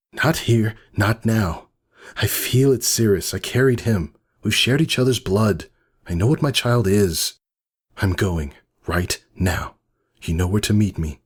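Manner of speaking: lightly voiced, set on leaving; determined Whisper. Whisper tells Cirrus she's leaving, lightly voiced and firm.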